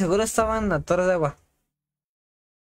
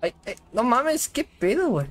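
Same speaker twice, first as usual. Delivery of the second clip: surprised; 6 semitones higher than usual. Real, not acted. Surprised reaction in game.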